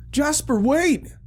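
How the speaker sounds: high, loud call, a little slow; urgent shout. Acted. Simon shouting after his dog as it bolts past, urgent high yell.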